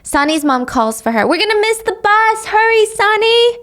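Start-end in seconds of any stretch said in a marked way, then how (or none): 1.3-3.6 much higher, called from a distance; calling out. Acted. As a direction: hurry her along from afar. Mom calls Sonny from afar.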